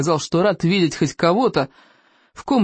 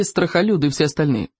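usual speaking voice

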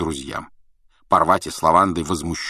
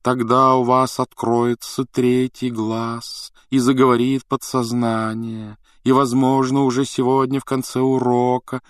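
higher, slow, drawn-out purr; purring singsong; 6 semitones higher, slower than usual. A narrator's reading. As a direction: purr it dreamily, stretching the phrase endings. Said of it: "Трелони продолжала мурлыкать". (мурлыча, нараспев)

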